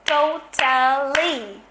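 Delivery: Totally drawn out slowly in three beats, to-tal-ly; slow, spaced to-tal-ly; syllable beats. Shown as a demonstration. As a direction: beat out each syllable of Totally.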